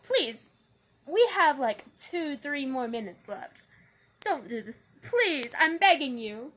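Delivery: loud desperate begging; pleading. Real, not acted. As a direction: beg the broken gear, exasperated. Camera stand breaking mid-video.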